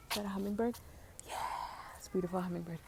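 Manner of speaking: whispering about a hummingbird. Real, not acted. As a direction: breathe it so you don't startle the bird.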